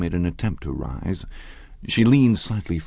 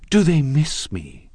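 high, slow, thrilled question; ecstatic cry; 10 semitones higher, 5 dB louder, slower than usual. Acted. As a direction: gush it out with delight. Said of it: "she cried ecstatically".

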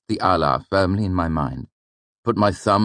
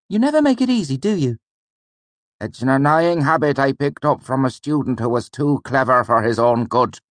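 much higher, put-on teacher voice; a higher character voice for the mentor; voiced old mentor Ben; 10 semitones higher, 5 dB louder than usual. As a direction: play the wry old teacher with mock gravity. Ben teasing his student.

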